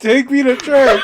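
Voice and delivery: laughing mock yell; joking shout. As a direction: belt it out like a rallying cry.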